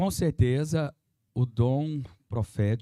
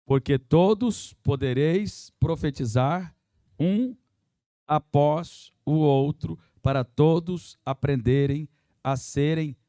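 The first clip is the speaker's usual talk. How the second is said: scripture reading; 5 dB louder, slower than usual. Acted. Reads scripture verse aloud.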